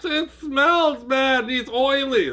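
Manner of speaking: high, drawn-out kiddie whine; whining child. Acted. Child refusing mall Santa's smelly lap, high whine.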